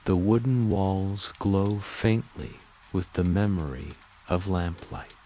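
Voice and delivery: ordinary delivery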